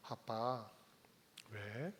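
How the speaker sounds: 아주 작고 낮게 아버지에게 묻는 아이; 조심스럽게 소곤대듯 묻기; mild quiet child question. Acted. 어린 이삭이 아버지에게 묻다. (아버지에게 묻는 작고 낮은 목소리)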